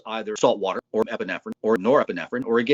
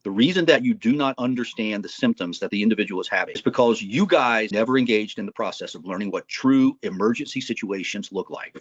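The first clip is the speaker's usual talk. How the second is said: ordinary talk, nothing marked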